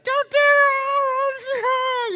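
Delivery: a screeching high cry, slow and loud; wife's frantic cry. Retold or imitated. Wife begging husband not to push the empty tank, frantic cry. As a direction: wail it out as the panicked wife. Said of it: "your wife's like".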